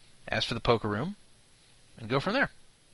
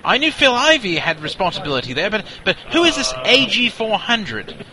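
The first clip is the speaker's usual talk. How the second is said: pompous British colonel, higher and louder; Colonel Nigel Fabersham; 5 semitones higher, 5 dB louder than usual. Acted. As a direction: bluster like an indignant old officer. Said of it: "Colonel Nigel Fabersham here".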